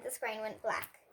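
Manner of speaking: ordinary delivery, nothing marked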